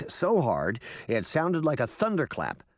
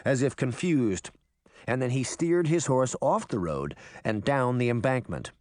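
ordinary delivery, nothing marked

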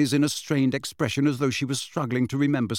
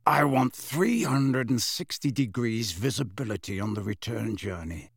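put-on gruff old wizard. Moody briefing the guard, gruff. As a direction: play a grizzled wizard giving orders.

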